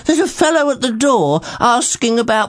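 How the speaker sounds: put-on high, hesitant old servant; nervous servant voice. Servant Groggins speaks.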